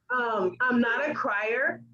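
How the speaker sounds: a bit teary. Real, not acted. Still emotional after tearful quote.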